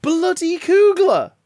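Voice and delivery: overwhelmed with glee; excited outburst. Real, not acted.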